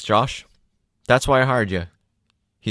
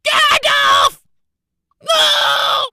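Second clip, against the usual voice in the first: anguished Frodo cry; 6 dB louder, much slower than usual. Acted. Frodo crying out at bridge.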